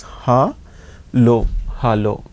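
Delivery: ha, lo split, then hello as "hah-lo"; German hello, first vowel an open "ah"; German pronunciation. Shown as a demonstration. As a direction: sound out ha and lo, then blend hello with an "ah". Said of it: "A is A sound".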